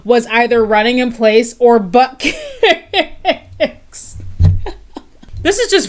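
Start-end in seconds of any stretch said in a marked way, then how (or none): 1.1-5.9 words broken by real laughter; laughing. Real, not acted. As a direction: laugh through the last word. Laughs while reading search results.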